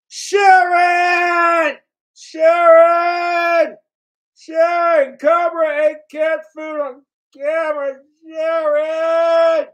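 high, loud, strained [name]-style shout; [name] yelling for Sharon. Retold or imitated. Imitating [name] shouting across the house for his wife. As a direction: bellow for Sharon like a frazzled rocker. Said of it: "Taking a leaf out of [name]'s book".

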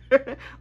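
quick burst of real laughter; short laugh. Real, not acted. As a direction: laugh at the memory. Nervous laugh in story.

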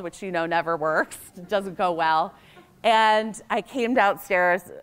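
ordinary talk

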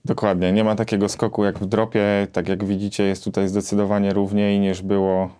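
usual speaking voice

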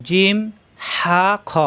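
ordinary delivery

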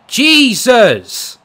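loud, high cry of exasperation; exasperated yell. Real, not acted. Gamer caught in the fight, exasperated yell. Gamer reacts to getting hit.

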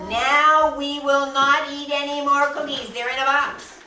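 loud, high proclamation; emphatic declaration. Acted. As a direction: announce it firmly at the top of your voice. Frog firmly declares the cookies off limits.

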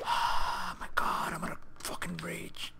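breathy whisper under a sigh; whispered frustration. Real, not acted. Player whispering to himself before raging, quiet. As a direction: hiss it through held-back rage.

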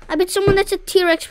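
normal talking voice

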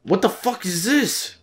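high, slow squawk of disbelief; real high-pitched outburst, words stretched; incredulous. Real, not acted. Player facing an unexpected encounter, incredulous.

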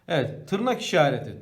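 ordinary talk, nothing marked

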